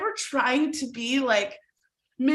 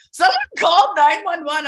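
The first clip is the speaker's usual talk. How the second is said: higher, louder alarmed announcement; alarmed administrators; 5 semitones higher, 7 dB louder than usual. Retold or imitated. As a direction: staff sounding the alarm.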